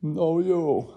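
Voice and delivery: one-up him in a high voice; hammed-up billionaire brag; bragging tycoon. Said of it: "He's like".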